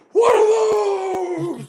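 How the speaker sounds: very high, loud, slow shouted meme. Retold or imitated. Quoting the dead meme to his cohost, loud high shout. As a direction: stretch it out like the viral clip.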